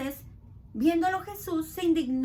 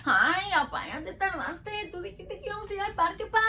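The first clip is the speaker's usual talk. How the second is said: put-on complaining kid, quick and pushy; whiny child; 4 semitones higher than usual. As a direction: nag dad about the park. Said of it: "¿cómo empieza el niño?".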